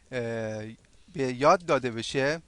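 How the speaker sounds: ordinary talk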